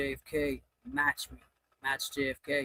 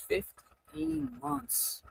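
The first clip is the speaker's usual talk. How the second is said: slow amazement; 7 semitones higher, 7 dB quieter, much slower than usual. Real, not acted. Realizing an anniversary.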